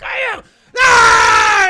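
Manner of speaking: high, very loud battle yell; hammed-up war cry; pumped-up roar. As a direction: roar like a leader rallying the troops. Acting out biker leader fired up.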